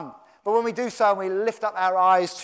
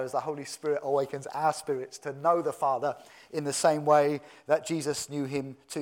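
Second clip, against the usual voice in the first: ordinary talk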